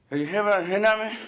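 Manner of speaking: high, loud, fast mumbled cashier question; imitating cashier. Retelling the Subway cashier's strange question, mumbled high and loud.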